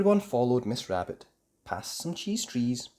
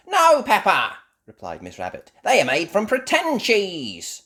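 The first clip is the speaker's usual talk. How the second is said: high, loud, kindly correcting; Miss Rabbit; 10 semitones higher, 10 dB louder than usual. Acted. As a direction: gently set Peppa straight.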